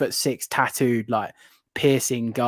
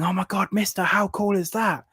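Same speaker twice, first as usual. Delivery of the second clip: put-on awestruck seven-year-old; 8 semitones higher than usual. Seven-year-old looking up at reptile guy, awestruck. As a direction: voice an amazed little kid, pitched high. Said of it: "myself when I was seven, looking up at the reptile guy".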